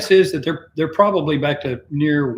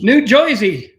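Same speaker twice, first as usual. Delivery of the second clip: mock New Jersey accent; 6 semitones higher, 6 dB louder, slower than usual. Mocking Jersey pronunciation.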